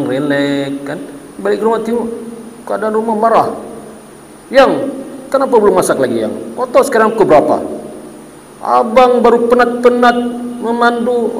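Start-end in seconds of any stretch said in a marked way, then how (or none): 4.5-11.4 higher, faster scolding of the wife; hot-tempered husband. Retold or imitated. Husband just home scolds his wife over dinner, higher and fast. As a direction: scold her for dinner being late.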